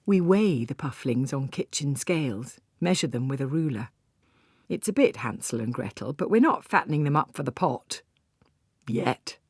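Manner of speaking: ordinary delivery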